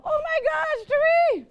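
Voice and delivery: high, loud, frantic yell of the mother; imitating mother yelling in a panic. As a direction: shriek for help like a panicked mom. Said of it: "she yelled out".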